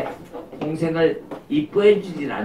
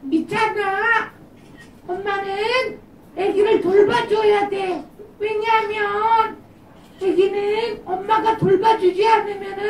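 much higher, airy little spirit voice; tiny high voice of a good conscience; high gentle spirit voice. Acted.

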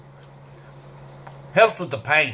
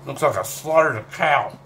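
usual speaking voice

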